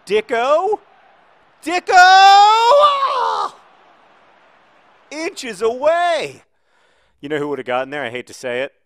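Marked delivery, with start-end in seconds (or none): 0.1-3.6 loud high calls exploding into a scream; rising anticipation shout. Real, not acted. Gamer watching Dicko shoot and miss, screaming. 5.1-6.4 high, loud, stretched-out wail; anguished yell. Real, not acted. Gamer lamenting a shot inches away, anguished yell. Agony after near miss.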